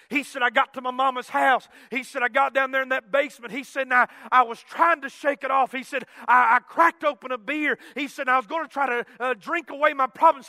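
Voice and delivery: loud, high, rapid-fire sermon delivery; full-force preaching. Retold or imitated. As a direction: push breathlessly from phrase to phrase.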